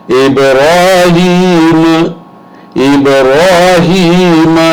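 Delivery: ابراہیم recited slowly, long ی, ending on a closed م; careful recitation. Shown as a demonstration. Teacher reciting Ibrahim for students to copy, slow and careful. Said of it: "یہاں پہ بہت خاص غور کیجئے".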